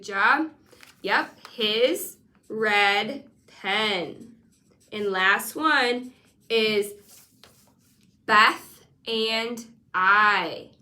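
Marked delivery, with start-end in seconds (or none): 1.5-4.3 his red pen, very slowly; slow model phrase. Shown as a demonstration. Teacher modelling a phrase slowly. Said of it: "You say it". 8.3-10.7 his red pen, very slowly; slow model phrase. Shown as a demonstration. Teacher modelling a phrase slowly. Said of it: "Repeat it".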